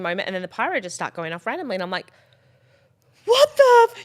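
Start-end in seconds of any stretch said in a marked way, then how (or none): 3.2-4.0 high, loud startled cry; shocked outburst. Retold or imitated. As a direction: cut yourself off mid-curse. Shock at pyro.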